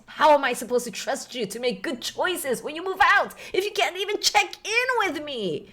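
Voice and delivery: high, loud exasperated scolding; dad yelling at his daughter about trust. Acted. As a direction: scold her, voice rising.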